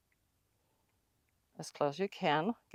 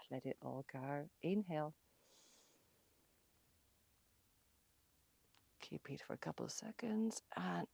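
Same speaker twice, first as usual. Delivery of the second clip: calm breathing guidance; 2 semitones lower, 9 dB quieter than usual. Acted. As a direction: guide the breath gently. Guides the breathing.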